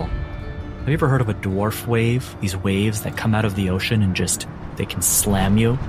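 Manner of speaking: usual speaking voice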